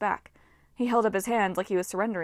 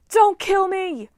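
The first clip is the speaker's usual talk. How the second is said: high, loud, slow terrified shriek; panicked scream; 10 semitones higher, 10 dB louder, slower than usual. A narrator's reading. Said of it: "All the satyrs started screaming".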